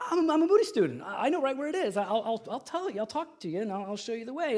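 high, stumbling mock eagerness; hammed-up naive student jumping to help; eager volunteer. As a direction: imitate an overexcited friend, pitched well up. Acts out Carl eagerly volunteering.

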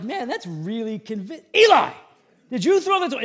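high, loud bewildered dad; confused dad. Retold or imitated. Father questioning his son, high and loud confused. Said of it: "And I'm like".

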